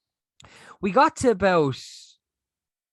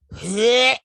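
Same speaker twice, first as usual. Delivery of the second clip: mock retching noise; 6 semitones higher, 9 dB louder than usual. Acting out vomiting in taxi.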